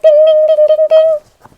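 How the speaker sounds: high, loud sing-song ding; a ringing high alarm chime; bell ringing. Retold or imitated. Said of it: "it's like".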